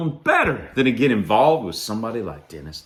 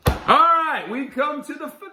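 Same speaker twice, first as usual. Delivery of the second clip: high-pitched finale announcement; showy announcement. Pretend. Host to viewers at the finale, high showy announcement.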